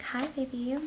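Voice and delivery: a hint of a soft cutesy partner voice; cutesy baby voice. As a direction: coo it like to a lover. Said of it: "you change your voice when you talk to them".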